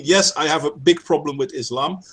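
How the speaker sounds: ordinary delivery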